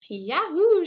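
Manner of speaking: loud, high cowboy whoop; shouted yahoo. A narrator's reading. Bubba whooping as he rides off to the ball, loud. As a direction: let out a big joyful yell. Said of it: "shouted Bubba".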